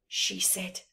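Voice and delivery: usual speaking voice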